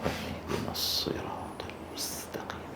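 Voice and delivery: whispered recitation, the sad of الصراط still hissing clearly; whispered prayer. Shown as a demonstration. Teacher showing how a lone worshipper's whisper still lets the sad be heard. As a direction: whisper it like praying alone. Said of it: "تسمع منه حروف الصفير".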